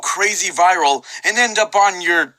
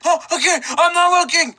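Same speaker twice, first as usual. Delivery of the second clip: high panicked voice; 11 semitones higher than usual. Acted. Imagined dudes' shouting scene.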